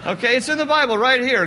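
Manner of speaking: normal talking voice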